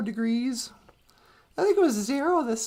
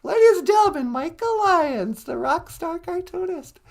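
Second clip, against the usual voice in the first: announcer intro; 3 semitones higher, 3 dB louder than usual. Acted. Introducing guest like a host.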